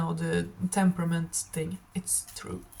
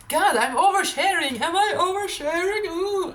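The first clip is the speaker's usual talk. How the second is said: high, louder burst of mock alarm; embarrassed outburst; 9 semitones higher, 3 dB louder than usual. Real, not acted. Vlogger catches herself telling too much, high embarrassed outburst. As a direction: catch yourself oversharing, pitched way up. Sudden self-aware exclamation.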